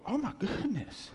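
high, falling exclamation; dawning shock. Acted. Listener realising God gets mad, high and aghast. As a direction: react aghast as it sinks in.

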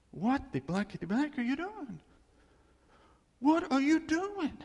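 high-pitched, exasperated outrage; mock cussing. God confronting sex outside marriage, exasperated. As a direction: demand it in indignant disbelief. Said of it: "I think he would cuss".

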